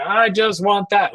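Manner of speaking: put-on high, slow pleading toddler whine; mock toddler insisting on one toy; whiny toddler demand. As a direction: stretch it out like a stubborn kid. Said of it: "talking with a screaming toddler".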